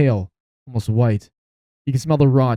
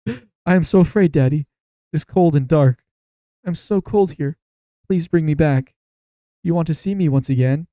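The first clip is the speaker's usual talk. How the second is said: higher-pitched, lightly voiced frightened son; dead son's voice; 5 semitones higher than usual. Dead son pleading with Daddy to bring him back, higher voice.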